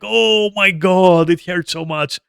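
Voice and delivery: imitating a pained, drawn-out groan of complaint; mock pained wailing. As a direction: stretch it out high, mimicking someone whining in pain. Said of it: "pace around and going like".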